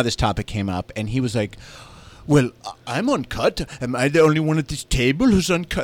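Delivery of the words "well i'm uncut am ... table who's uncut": a hint of a bad South African accent; terrible South African accent. Host mimics a South African dinner guest, bad South African accent. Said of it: "That's my terrible South African accent".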